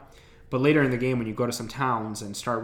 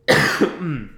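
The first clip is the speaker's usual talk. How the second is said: loud harsh cough; coughing fit; 2 semitones higher, 6 dB louder than usual. Real, not acted. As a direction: cough hard, then apologize. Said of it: "sorry about this coughing".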